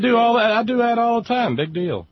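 hammed-up high whiny mock of a pundit. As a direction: whine it out like a smug brat. Mocking Beck's whiny voice.

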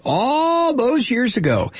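high, slow, drawn-out "all"; mock singsong irony. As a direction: stretch it out high with sarcasm. Ironic aside about old bombings.

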